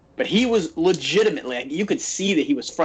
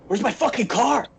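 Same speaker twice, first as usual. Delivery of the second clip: raised pitch, mock-alarmed shout; retold panicked yell; 8 semitones higher than usual. Imitating the wrestler yelling for his stolen car, mock panic.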